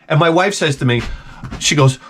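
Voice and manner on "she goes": ordinary talk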